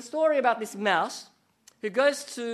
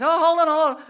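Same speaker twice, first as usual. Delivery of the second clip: frantic mouse stalling; 10 semitones higher, 11 dB louder, faster than usual. Acted. Mouse stops the snake.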